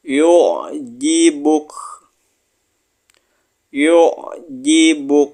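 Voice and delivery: slow chanted Arabic; Yu'jibuk recited slowly, final kaf breathed out; Quran recitation. Shown as a demonstration. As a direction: intone it like a tajwid teacher. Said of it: "Kita pindah ke baris keenam".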